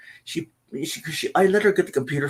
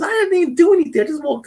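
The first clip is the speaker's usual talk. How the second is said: much higher and louder, a defensive daughter; daughter voiced; 8 dB louder, faster than usual. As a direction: squeak out the daughter's protest. Daughter's reply voiced in story.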